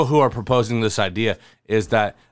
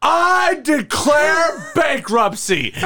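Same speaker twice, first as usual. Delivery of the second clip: high, slow, shouted declaration through a laugh; mock proclamation; 13 semitones higher, slower than usual. Imitating Steve Carell to a cohost, mock shouted proclamation. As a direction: boom out the big announcement, cracking up. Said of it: "he just goes out into the office and he says".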